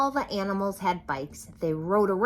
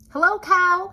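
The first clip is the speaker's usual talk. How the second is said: much higher, louder duck greeting; cheery duck; 13 semitones higher, 11 dB louder than usual. A narrator's reading. Duck riding past the farm animals, high cheery greeting. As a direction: sing out hello like a jolly duck.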